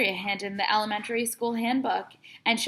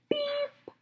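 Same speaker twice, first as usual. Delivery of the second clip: high flat electronic beep; be the lie detector going off; machine beep; 4 dB quieter than usual. A narrator's reading. Lie detector catching the boy's lie, high flat beep.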